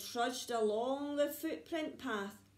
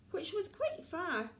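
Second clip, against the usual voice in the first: ordinary delivery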